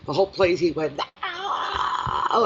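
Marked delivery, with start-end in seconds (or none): 1.2-2.4 high-pitched imitation scream, held back in volume; very high, contained retold scream; mock scream. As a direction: imitate his long scream without full volume. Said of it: "he screamed ... A big, long scream".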